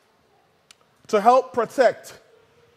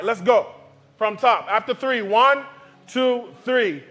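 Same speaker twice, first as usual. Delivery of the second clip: ordinary delivery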